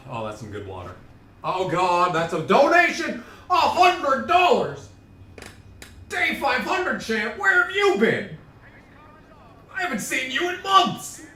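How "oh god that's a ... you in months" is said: very high, loud excited squealing; ecstatic surprise. Real, not acted. Streamer greeting a long-lost donor, ecstatic high shouting. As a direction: shriek with delight, climbing far above usual pitch. Reacting to a big donation.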